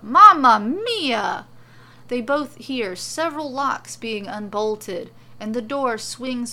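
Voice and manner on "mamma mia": loud, drawn-out exclamation; big theatrical outcry; crying out. A narrator's reading. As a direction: belt it out high and slow. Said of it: "a voice cries out".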